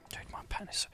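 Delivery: hushed whisper so no one wakes; library whisper. Real, not acted. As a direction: whisper so sleepers stay asleep. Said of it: "I'm just whispering because people are sleeping".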